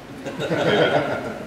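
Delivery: short high-pitched laugh; a quick burst of amusement; amused laugh. Real, not acted. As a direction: laugh at your own aside. Joking about [name] comparison.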